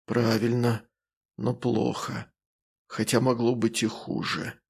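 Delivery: measured and whispery; calm rustling voice. Acted. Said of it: "голос остался спокойно шелестящим".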